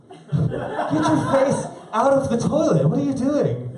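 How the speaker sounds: high, slow, laughing scold. Real, not acted. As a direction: laugh it out in disbelief. Laughing at self.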